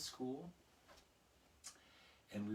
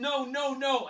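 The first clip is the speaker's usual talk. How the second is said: prim refusal; 14 semitones higher, 14 dB louder, slower than usual. Retold or imitated.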